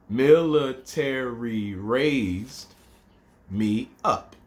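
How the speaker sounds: very slow, drawn-out haiku line; slow recitation. Shown as a demonstration. Teacher reciting second haiku line very slowly. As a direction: stretch each syllable out. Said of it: "first line's five".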